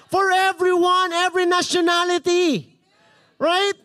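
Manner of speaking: high, loud preacher calling out; excited, projected pulpit shout; rousing roll call. Real, not acted. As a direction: call each group out across the church. Calls out nationalities to congregation.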